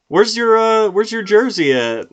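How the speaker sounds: ordinary delivery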